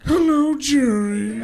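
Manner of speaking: loud, high sneering sitcom greeting; Newman. Acted. Neighbour greets his rival Jerry, Newman impression. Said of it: "the guy who plays Newman".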